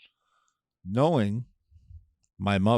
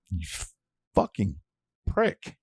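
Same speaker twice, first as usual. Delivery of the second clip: outraged. Retold or imitated. Ranting at driver.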